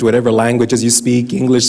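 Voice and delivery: usual speaking voice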